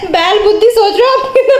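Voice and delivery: laughing teasing. Real, not acted. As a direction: tease through laughter. Laughing insult at brother.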